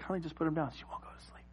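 imitating a wife's near-whisper; very soft, barely voiced suggestion; hushed wife. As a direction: keep it hushed, as if over a sleeping baby. Wife whispering while baby sleeps.

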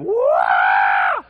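full-voiced mock baby scream; screaming baby imitation. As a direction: wail like a screaming newborn. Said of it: "like, screaming".

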